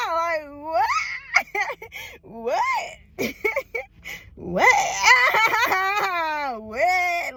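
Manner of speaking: high breaking laugh that slides into crying; real laughter close to tears; laughing and crying at once; laughing through tears. Real, not acted. Overwhelmed laughing about losing parents.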